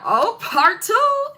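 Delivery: high, slow, loud mock "part two"; teasing. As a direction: savour the gossip, drawn out. Goofy teasing about last time.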